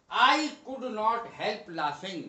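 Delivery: each word drawn out very slowly for learners; slow dictation. Shown as a demonstration. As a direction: spell it out slowly like a teacher. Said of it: "मैं अपनी हंसी रोक नहीं पाया".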